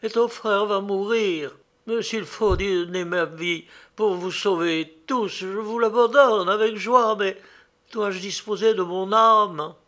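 ghostly mother. A narrator's reading. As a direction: lament like a suffering ghost. Ghost mother laments and bargains.

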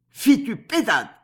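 put-on high, loud German-accented outburst; Nucingen's indignant cry. A narrator's reading. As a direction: exclaim sharply with a Germanic accent. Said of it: "s'écria Nucingen". (exclamation indignée à l'accent allemand)

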